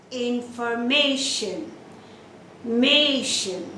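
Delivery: Information with long MA, then Mation alone; second-last syllable stress. Shown as a demonstration. Teacher shows the rule, stress on MA in Information and Mation. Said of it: "the second syllable from the last".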